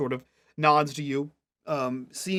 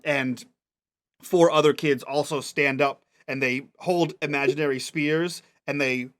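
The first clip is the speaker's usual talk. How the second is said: usual speaking voice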